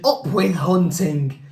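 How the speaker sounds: slow, chanting storybook shout; shouted slogan. A narrator's reading. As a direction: chant it slowly like a rallying cry. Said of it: "And they shouted".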